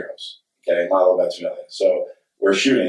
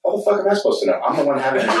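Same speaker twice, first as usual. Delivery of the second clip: higher-pitched incredulous comeback; mock exasperation; 7 semitones higher, faster than usual. Son answering an actor's awkward question, retold with mock exasperation. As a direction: throw it back, amused and baffled.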